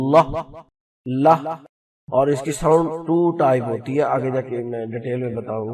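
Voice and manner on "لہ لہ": crisp "لہ" said twice, a clean light l; the l sound demonstrated; l sound demo. Shown as a demonstration. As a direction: tap out "لہ" with the tongue. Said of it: "اور یہ اس کا sound ہے".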